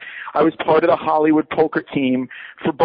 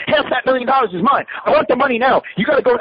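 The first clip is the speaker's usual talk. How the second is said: higher, pushy impression of a greedy friend; mock demanding friend; 8 semitones higher than usual. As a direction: demand the money in a raised, altered voice. Friend demands half the money.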